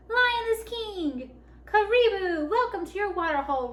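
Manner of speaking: high, triumphant royal welcome; roaring. A narrator's reading. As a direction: call out jubilantly to all the animals. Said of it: "roared Lion".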